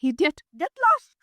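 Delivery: jilted bride ordering the cheat out; furious dismissal. Acted. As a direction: throw him out with scorn. Daughter throws the groom out.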